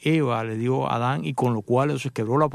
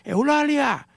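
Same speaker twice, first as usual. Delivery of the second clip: calling out; 11 semitones higher, much slower than usual. Retold or imitated.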